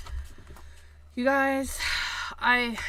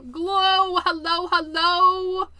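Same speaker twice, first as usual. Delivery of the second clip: playful sing-song; 7 semitones higher, 7 dB louder, slower than usual. Real, not acted. Playful sing-song greeting to paper.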